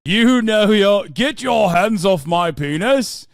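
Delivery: loud, slow, hammed-up cry; mock dramatic shout. Streamer hamming a movie line, slow mock shout. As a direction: declaim it like a wronged hero.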